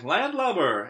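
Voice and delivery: loud, high pirate insult; pirate talk. Acted. Boy showing off pirate words, loud. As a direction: spit it like a pirate. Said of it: "real pirate stuff like".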